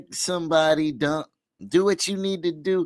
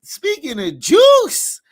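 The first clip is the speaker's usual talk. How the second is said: hype; 11 semitones higher, 7 dB louder, slower than usual. Real, not acted. Host hyping guest intro.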